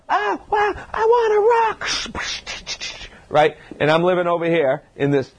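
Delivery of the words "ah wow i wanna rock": much higher, excited exclamation; a patient ready to rock, voice leaping way up; pumped-up patient. Acted. Patient imagined full of energy.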